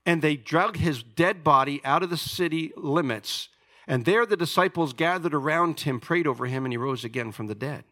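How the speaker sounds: fiery preacher reading scripture; preaching at full force. Acted. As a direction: proclaim Paul's boasts like a challenge. Retells the stoning with force.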